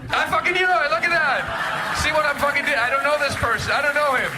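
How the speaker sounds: high-pitched crowing at a lucky guess; triumphant outburst. Real, not acted. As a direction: exclaim high, thrilled to be proved right. Mock triumph at guessing engineer.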